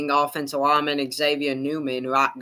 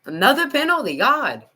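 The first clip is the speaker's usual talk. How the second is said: exasperated; 9 semitones higher, 4 dB louder than usual. Real, not acted. Exasperated repeat, pitch jump.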